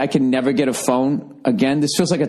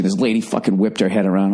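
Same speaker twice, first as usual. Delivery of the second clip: normal talking voice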